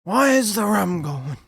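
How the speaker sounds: loud, high, hammed-up pirate cry; Jack Sparrow accent. Quoting a pirate film for a friend, hammed-up pirate accent. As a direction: bellow it like a bad pirate impersonation. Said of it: "use a Jack Sparrow accent".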